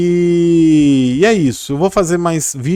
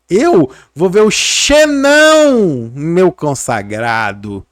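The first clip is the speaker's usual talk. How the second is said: playful catchphrase call; 3 semitones higher, 5 dB louder, much slower than usual. Acted. Husband teasingly answering his wife, playful drawn-out catchphrase. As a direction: belt out the name slowly like a catchphrase. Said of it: "Eu vou falar assim com ela".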